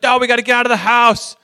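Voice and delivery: stressed dad. Retold or imitated. Dad snaps back at the kids.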